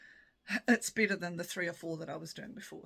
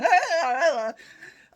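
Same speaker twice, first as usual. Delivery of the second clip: big high burst of laughter; loud laugh; 10 semitones higher, 15 dB louder than usual. Real, not acted. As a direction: crack up loudly at your own mishap. Laughing at own treadmill fall story.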